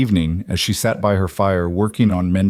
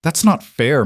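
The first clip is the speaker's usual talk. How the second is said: higher-pitched, mildly indignant protest; 7 semitones higher, 4 dB louder than usual. A narrator's reading. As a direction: object as if unjustly accused. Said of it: "Martha protested".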